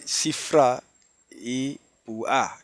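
Sifra as siFRÁ, Púa as puÁ, spaced out; final-syllable stress. Shown as a demonstration. Teacher demonstrating Hebrew names, Sifra and Púa stressed on final A. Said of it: "el acento es en la A".

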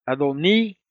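"Adoní" with a drawn-out, stressed í; stress on final í. Shown as a demonstration. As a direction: slowly land on the last syllable of "Adoní". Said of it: "con acento en la I".

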